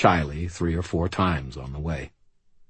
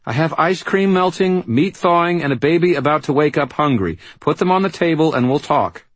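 high, brisk, no-nonsense woman; impatient bossy mother; 9 semitones higher, 5 dB louder than usual. Acted. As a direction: rattle off orders, short of patience. Charity voiced, impatient.

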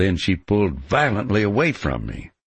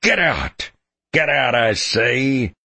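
high, loud furious roar; furious shout; 8 semitones higher, 3 dB louder than usual. A narrator's reading. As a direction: yell him out of the house. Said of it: "his voice hoarse with fury".

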